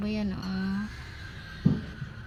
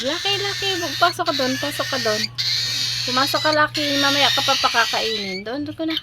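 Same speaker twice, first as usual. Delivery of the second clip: calling the pet; 5 semitones higher, faster than usual. Real, not acted.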